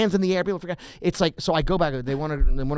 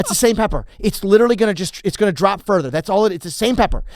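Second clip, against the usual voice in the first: higher, louder protest to a stubborn customer; exasperated waiter; 5 semitones higher, 6 dB louder than usual. Retold or imitated. Waiter pleading with a diner over the pepper mill, exasperated and loud. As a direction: lose patience explaining the obvious.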